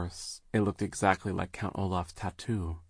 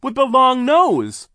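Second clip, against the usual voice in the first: high, slow shouted discovery; excited cry; 14 dB louder, slower than usual. Acted. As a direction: call out the recognition. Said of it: "Klaus cried".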